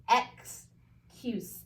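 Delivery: "Excuse" split as "ex", then "cuse"; broken into syllables. Shown as a demonstration. Teacher reads the parts to students, syllable by syllable. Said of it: "put those both together to read it".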